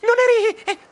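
high panicked stutter rising to a wail; terrified stammering. A narrator's reading. Narrator facing a supposed ghost, terrified high stammer. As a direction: stutter in terror, then wail the last word. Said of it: "Terrorizzato balbettai".

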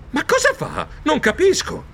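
high startled protest; high, flustered mouse protest. Acted. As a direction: squeak out a bewildered protest. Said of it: "Io protestai".

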